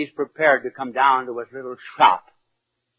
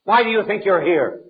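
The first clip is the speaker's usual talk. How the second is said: exasperated attorney; 6 semitones higher than usual. Retold or imitated. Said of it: "was exasperated".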